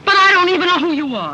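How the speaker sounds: loud protest. Acted. Woman protests to unknown suitor, exclaiming. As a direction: protest it loudly, pitch up.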